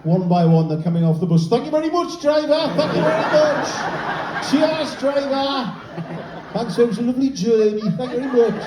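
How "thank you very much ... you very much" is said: high, loud, sing-song thanks; cheery Geordie passengers. Pretend. Geordie passengers being stretchered off, loudly thanking the driver in a high sing-song. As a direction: gush thanks to the driver with a big grin.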